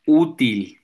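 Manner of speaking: "útil" with a strong first syllable; penúltima sílaba fuerte. Shown as a demonstration. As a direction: punch the ú in "útil". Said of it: "de la sílaba grave". ("útil", marcando fuerte la ú)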